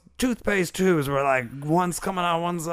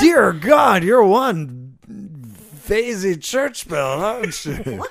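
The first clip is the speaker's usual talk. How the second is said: put-on old-timey insult; 3 semitones higher, 6 dB louder, much slower than usual. Insult hurled in character.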